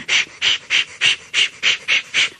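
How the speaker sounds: whispered shh puffing rhythm; soft unvoiced chuffing; engine puffs. A narrator's reading. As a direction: puff like a steam engine.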